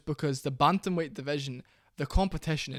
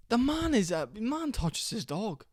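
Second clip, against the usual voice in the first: mock disbelief; 7 semitones higher, slower than usual. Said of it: "I was just like".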